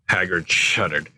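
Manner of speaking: plain reading voice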